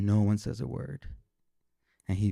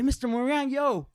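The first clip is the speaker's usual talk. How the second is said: put-on very high, insistent kid voice; amazed boy hollering questions; excited classmate; 4 dB louder than usual. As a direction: call out eagerly, demanding answers. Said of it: "I hear Trevor say".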